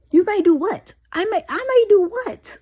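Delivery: loud, high-pitched echo question; indignant, stumbling repeat rising high at the end; incredulous retort. Acted. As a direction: throw it back in loud disbelief. Baffled retort to the doctor.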